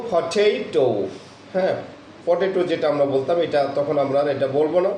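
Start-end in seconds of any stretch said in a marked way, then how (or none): 0.1-0.9 ফটেটো aspirated with stress on টে; phonemic potato. Shown as a demonstration. Teacher repeating the model word for the class, stressed. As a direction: say ফটেটো firmly, leaning on the middle. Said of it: "তাহলে কি বলবো?".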